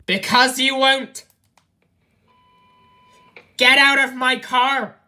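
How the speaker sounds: high, loud furious shout; real rage shouting at the passenger; angry yell. Real, not acted. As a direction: scream the order with no patience left. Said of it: "But you're not to yell at me".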